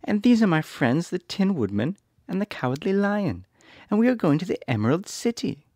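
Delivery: put-on high little girl voice; worried girl asking. A narrator's reading. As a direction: plead as young Dorothy. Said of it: "answered the little girl".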